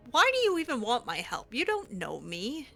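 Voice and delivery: ordinary delivery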